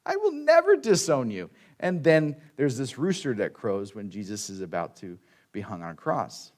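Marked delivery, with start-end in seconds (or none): 0.0-1.5 Peter's vow. Retold or imitated. Peter's vow voiced in retelling.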